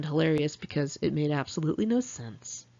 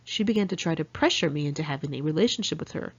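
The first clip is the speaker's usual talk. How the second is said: ordinary delivery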